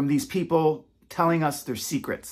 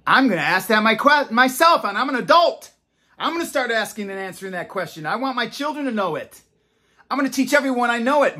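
much higher, insistent declaration; emphatic resolve; 9 semitones higher, 3 dB louder than usual. Real, not acted. Adult vowing to teach their children, emphatic. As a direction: proclaim it with rising fervour.

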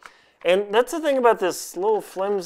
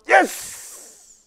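high victory yell; fist pump cheer. Real, not acted. Vlogger doing the promised fist pump, high cheer. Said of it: "do the fist pump".

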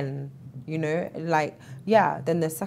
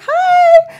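much higher and louder squeaky hi; eager hi; 13 dB louder than usual. Retold or imitated. Answering the nurse's call, a high hopeful squeal. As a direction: jump at the phone hopefully. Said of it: "I was like".

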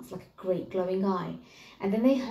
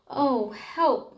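whimpering; 5 semitones higher, 4 dB louder than usual. Acted. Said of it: "whimpered the lion".

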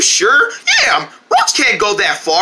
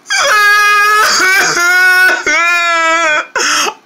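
puppet sobbing; 8 semitones higher, 3 dB louder than usual. Acted. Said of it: "Oh, don't cry".

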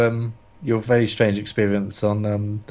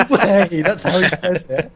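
laughing amazement; 7 semitones higher, 3 dB louder than usual. Retold or imitated. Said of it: "I was like".

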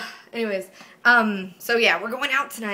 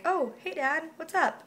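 mock cheerful greeting; 7 semitones higher than usual. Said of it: "and I'm like".

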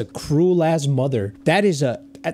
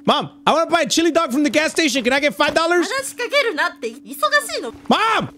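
put-on whiny kid; 10 semitones higher than usual. Whining kid asking mom.

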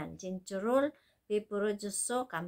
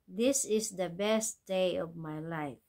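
slow model phrase; slower than usual. Shown as a demonstration.

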